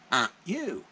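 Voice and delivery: high, loud mock parrot squawk; imitated parrot. Customer quoting the parrot's insult to its owner, loud imitated parrot voice. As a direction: mimic the parrot's loud taunt. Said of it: "he says".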